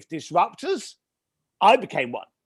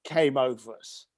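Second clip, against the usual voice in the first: ordinary talk, nothing marked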